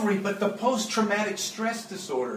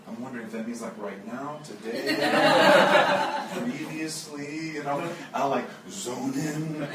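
laughing inner aside; 7 semitones lower than usual. Real, not acted.

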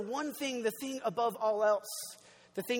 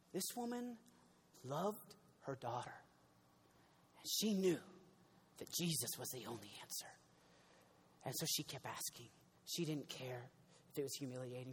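much softer, low preaching; hushed sermon; 10 semitones lower, 14 dB quieter than usual. Acted. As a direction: draw the room in with a soft, earnest hush.

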